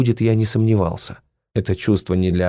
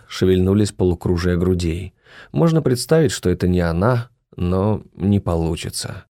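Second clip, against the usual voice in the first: ordinary delivery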